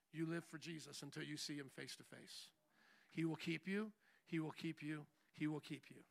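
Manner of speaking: soft, low, gentle counsel; tender pastor voice. Acted. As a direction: speak softly like a caring pastor.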